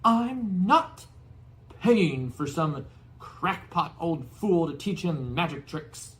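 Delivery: high, loud slow yell. Acted. Uncle Vernon yelling at Hagrid, high loud slow. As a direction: yell in outrage. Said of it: "yelled Uncle Vernon".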